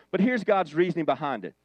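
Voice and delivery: ordinary talk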